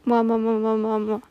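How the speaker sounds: kiss sounds. Real, not acted. Blowing kisses to listener.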